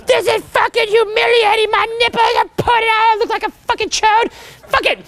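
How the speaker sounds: high, whiny complaining voice; hammed-up squeal of a cold swimmer; mock whiny swimmer. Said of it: "they go".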